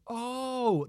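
long, high-pitched "oh" of discovery; drawn-out dawning realisation; mock realisation. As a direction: stretch it out high as the penny drops. Acting sudden realisation on seeing casting.

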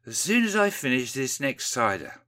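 blurry, tipsy. A narrator's reading. Windy putting it off for another cider, blurry. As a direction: play it mildly drink-blurred. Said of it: "says a blurry Windy".

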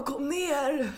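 calling out. Retold or imitated. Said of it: "Jag bara".

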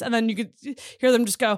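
usual speaking voice

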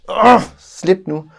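exasperated plea. Retold or imitated. Shaking daughter's hand off.

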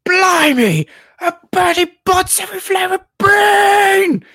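very high, squealing amazement; over-excited Ron. Retold or imitated. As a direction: shriek it in hammed-up boyish excitement, drawing out the last word. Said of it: "the most excitable character in this game".

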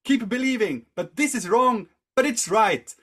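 high, loud acted outburst; vehement exhortation. Monk's acted conflicted outburst.

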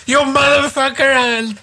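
hammed-up threat; mock furious outburst. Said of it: "Ah, you Pakistani brown boy".

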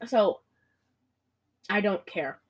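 normal talking voice

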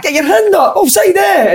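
high squeaky mock yell; mock shouting coach. Retelling the Falkirk guy yelling at the ref, high mocking shout. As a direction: ham up a ranting touchline guy. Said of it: "he was shouting non-stop the full game".

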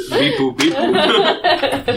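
high slow robot beeps; toy robot voice. Pretend. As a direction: beep it like a toy droid. Playing a droid, beeping.

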